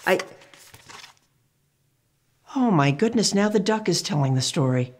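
ordinary talk

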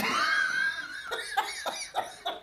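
high, breathless unvoiced laugh. Real, not acted. Laughing at own story.

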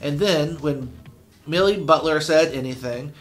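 ordinary delivery, nothing marked

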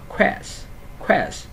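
"Quest" as a short stressed syllable ending in hissed s; trọng âm âm đầu. Shown as a demonstration. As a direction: stress "quest" and let the s hiss. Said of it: "đọc cao lên một xíu". ("quest" nhấn mạnh, rõ âm s cuối)

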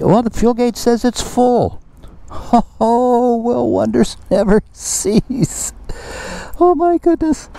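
very high falsetto astonishment; hammed-up high-pitched wonder; mock amazement. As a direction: squeal it like a shocked old lady. Mock surprise at full gauge.

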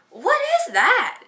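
very high, slow, drawn-out what is that; a husband baffled by the bag; puzzled surprise. Retold or imitated. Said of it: "and he's like".

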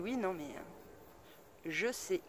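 soft, slow, trailing reluctant admission; hesitant concession. Retold or imitated. The neighbour conceding to her after their quarrel, quietly hesitant. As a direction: give in quietly, word by word.

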